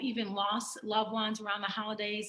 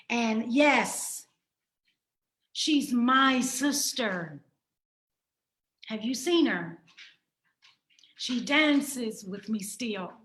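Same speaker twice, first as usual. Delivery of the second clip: poem reading; 2 semitones higher, 5 dB louder than usual. Acted. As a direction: recite it like a heartfelt poem to a lost sister. Reads her poem aloud.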